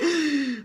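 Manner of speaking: a quick shocked intake of breath; sharp triggered gasp. Shown as a demonstration. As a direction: gasp as if caught off guard. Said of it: "like that".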